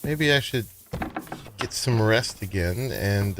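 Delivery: usual speaking voice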